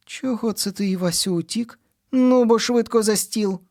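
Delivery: a mum scolding then calling him to the table; mother calling. A narrator's reading. Mother calls son to table.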